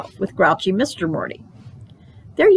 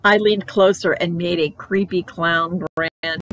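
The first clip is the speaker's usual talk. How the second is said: ordinary delivery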